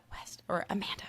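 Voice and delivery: hushed whisper. Real, not acted. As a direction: whisper it like a secret vow. Wishing the saga over.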